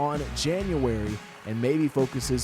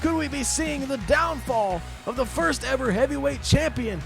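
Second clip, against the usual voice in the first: raised, high-pitched wrestling commentary; excited match recap; 8 semitones higher than usual. Real, not acted. Commentator telling folks of a shock defeat, excited. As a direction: keep the thrill of the finish while summing up.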